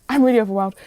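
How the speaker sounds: loud, overwhelmed cry into the phone; half-laughing mock shout of panic; retold shout. Calling mom on the phone, overwhelmed, loud. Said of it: "shouting at her down the phone".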